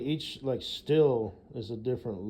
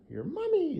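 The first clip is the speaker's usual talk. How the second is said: high mommy voice; 4 dB louder than usual. Retold or imitated. Mocking mom voice.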